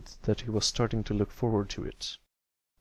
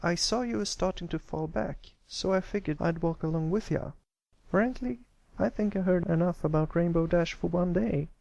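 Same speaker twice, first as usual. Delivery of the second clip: high filly voice, friendly and chatty; a farm filly keeping a colt company; young country filly; 8 semitones higher than usual. Acted. Apple Bloom walking alongside Pipsqueak, high friendly filly voice.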